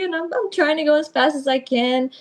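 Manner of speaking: ordinary talk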